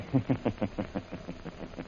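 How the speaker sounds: low, soft sinister chuckle; evil low laugh; villain chuckle. Acted. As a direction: gloat quietly. Villain gloating.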